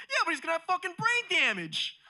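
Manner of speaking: high, loud panicked dad impression; mock hysterical yell. Dad to son who says he's alive, mock hysterical yell. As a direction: yell back, refusing to be comforted.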